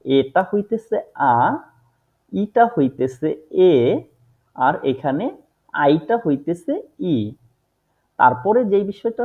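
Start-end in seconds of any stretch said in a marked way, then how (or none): 0.1-7.2 আ, এ, ই spelled out slowly, each vowel held; slow, deliberate German vowel names; slow careful letter names. Shown as a demonstration. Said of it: "তিনটা বিষয় একটু খেয়াল রাখতে হবে".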